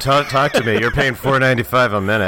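high, loud hotline pitch; mock impatient operator. Operator hurries a caller paying by the minute, loud and high. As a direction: push the caller loudly to get talking.

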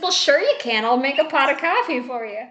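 light high mock mom; breezy mom. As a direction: brush it off like a cheery host. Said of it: "she's like".

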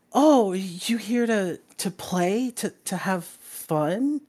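put-on high, halting small child voice; little kid. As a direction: ask slowly and hopefully as a small child. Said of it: "he's just like".

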